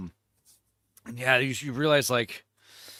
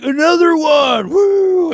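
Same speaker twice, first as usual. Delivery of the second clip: high, loud, slow demand for more; mock drunken shout; 13 semitones higher, 9 dB louder, slower than usual. Drunk guy shouting for another beer, high loud whoop. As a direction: yell for another round like a drunk.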